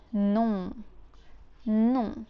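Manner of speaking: non said slowly with a nasal; drawn-out nasal non for learners; slow model of non; French non. Shown as a demonstration. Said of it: "To say no, we say".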